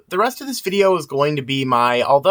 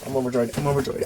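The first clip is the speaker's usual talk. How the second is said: low, softer read of I'm overjoyed, sinking away; quiet line take; 5 semitones lower, 6 dB quieter, much faster than usual. Shown as a demonstration. Voice actor testing takes, quiet low read. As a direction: try the line as a hushed murmur.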